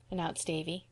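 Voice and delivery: plain reading voice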